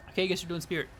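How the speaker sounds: ordinary talk, nothing marked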